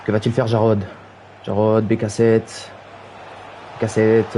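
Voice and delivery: ordinary talk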